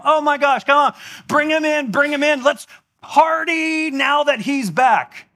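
overjoyed father calling everyone in, slowing at the end; ecstatic father. Retold or imitated. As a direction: shout for the party like a thrilled dad. Overjoyed father calls for party.

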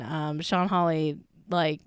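ordinary talk, nothing marked

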